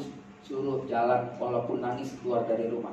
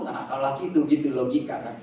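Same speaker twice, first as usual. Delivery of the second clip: usual speaking voice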